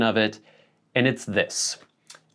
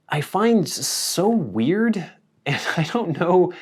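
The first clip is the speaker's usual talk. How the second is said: high, amused and incredulous; laughing disbelief; 9 semitones higher, slower than usual. Real, not acted. Vlogger finds the phrase so weird, laughing.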